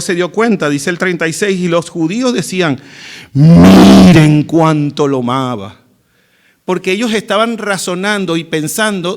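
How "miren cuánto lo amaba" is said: loud, slow, drawn-out start; emphatic exclamation. Acted. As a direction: draw out the first word loudly, marvelling. Voicing the onlookers' exclamation.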